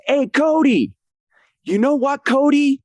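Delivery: high-pitched wrestler callout; Penta impression. Wrestler calls out Cody before the attack, high mock taunt. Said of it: "here he goes and he says".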